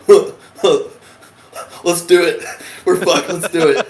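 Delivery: loud, huffed meathead imitation; dim brick Marsh. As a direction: grunt it like a gung-ho lunk. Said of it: "who's like, he's like".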